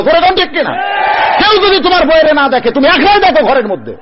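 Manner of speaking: high, loud haranguing sermon; full-force preaching. Acted. Preacher scolding young husbands before the crowd, loud and fiery. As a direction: hammer each phrase out to the crowd.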